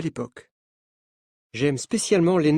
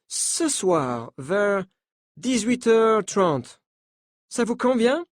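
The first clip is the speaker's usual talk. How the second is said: put-on higher French with a light American accent; 6 semitones higher, slower than usual. Coach telling the journalists not to panic, in a light American accent. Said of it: "dit-il avec un accent américain".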